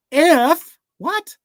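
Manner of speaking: If shot up high and loud in disbelief; incredulous echo. Retold or imitated. Jesus throwing the doubter's if back, incredulous. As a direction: throw the word back, astonished.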